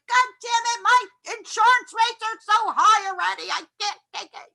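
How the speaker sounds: high, loud, exasperated cursing; frustrated outburst. Retold or imitated. Angry driver cursing over insurance rates, frustrated outburst. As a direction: vent about the insurance rates. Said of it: "You're like".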